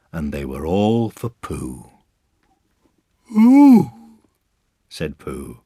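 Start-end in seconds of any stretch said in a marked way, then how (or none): 3.3-3.9 very high, loud coo; long high gasp of wonder; delighted ooh. A narrator's reading. Pooh seeing his pencil case, delighted ooh.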